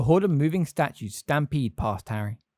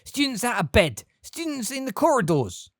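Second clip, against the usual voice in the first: put-on high, reedy old man yelling; shouting old caretaker; 11 semitones higher than usual. A narrator's reading. Old caretaker Filch raising the alarm, high thin shouts.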